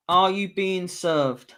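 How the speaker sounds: slow, drawn-out reveal of the title; drawn-out answer reveal. Shown as a demonstration. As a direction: stretch out "Are You Being Served?" like a big announcement. Reading out the quiz answer.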